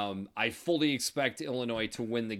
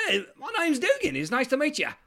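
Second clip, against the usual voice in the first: much higher, brighter hello; eager second try; 6 dB louder than usual. Acted. Young man tries greeting the old guy again, very high. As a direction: push the greeting way up, hopeful.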